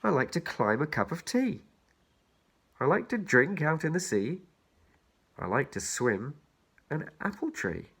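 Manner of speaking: put-on bouncy children's rhyme read. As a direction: lilt each line to its rhyme. Reads the rhyme aloud.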